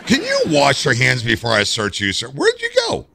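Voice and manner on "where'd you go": high, startled where'd you go; surprised question. Real, not acted. Searching for the prisoner.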